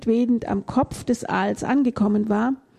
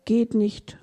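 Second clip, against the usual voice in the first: usual speaking voice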